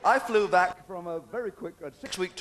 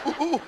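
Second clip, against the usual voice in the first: mock ooh noises; 10 semitones higher, 5 dB louder, much faster than usual.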